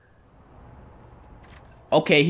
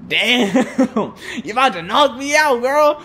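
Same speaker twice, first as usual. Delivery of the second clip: laughing, higher-pitched disbelief; laugh, then throw it back higher, teasingly; amused; 6 semitones higher than usual. Real, not acted. Amused by game character's threat.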